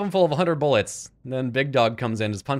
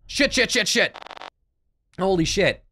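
rapid higher repeated curses; frantic under attack; real panic swearing mid-fight; panicked cursing; 6 semitones higher than usual. Real, not acted.

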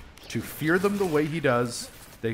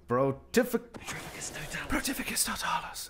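imitated whisper; 6 dB quieter than usual. Gamer copying the hero's stealth spell in a whisper. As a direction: whisper the incantation as the game's wizard does. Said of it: "It's a little weird when he whispers it".

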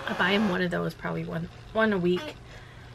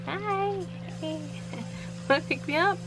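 put-on much higher, cute little-girl voice; toddler Journey voice; 10 semitones higher than usual. Mom imitates her toddler greeting strangers, high and cute. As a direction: squeak it like a tiny friendly toddler. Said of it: "Journey's just like".

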